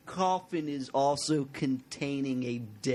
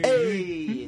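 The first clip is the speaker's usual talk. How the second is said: mock Italian accent; 8 semitones higher, 5 dB louder than usual. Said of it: "Italian accent".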